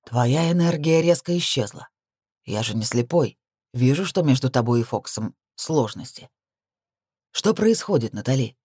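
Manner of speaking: lightly voiced male superior, mostly low; commander's questioning. A narrator's reading. Commander questions Natali about her trouble, lightly voiced.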